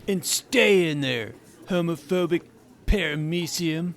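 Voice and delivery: bully voice. Retold or imitated.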